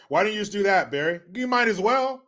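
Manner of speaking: ordinary delivery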